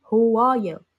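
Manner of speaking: put-on loud foreign accent, stress on "How"; mock accented greeting. Imitating Ate Aya's accented chat, stressing "How". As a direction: punch "How" hard and drag "are you" into a foreign accent. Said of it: "May accent yung pag-chat niya".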